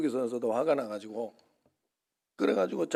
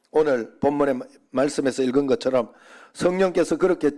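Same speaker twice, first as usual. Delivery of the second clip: louder, driving preaching voice; 6 dB louder than usual. Acted. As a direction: press the point home to the congregation. Preaching to the congregation. (힘있는 설교)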